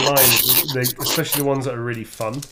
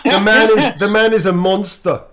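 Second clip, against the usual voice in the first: higher, louder hammed-up fury in a drifting accent; mock South African-ish outrage; 8 semitones higher, 7 dB louder, slower than usual. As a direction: rant about the goose in a put-on accent. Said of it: "heading towards South Africa".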